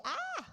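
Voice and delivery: sudden high yelp, acted as a bad example; mock cry of pain. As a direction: jokingly yelp as if the stretch hurts. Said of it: "And you shouldn't say".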